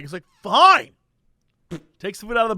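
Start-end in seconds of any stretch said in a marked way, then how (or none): 0.4-0.9 loud, high exasperated snap; short irate burst, louder and higher; mock shouting. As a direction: give in angrily, imitating the customer. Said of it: "just by shouting at people".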